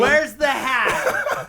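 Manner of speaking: high, drawn-out question through laughter; mock indignant demand. Co-host acts out the punchline, laughing.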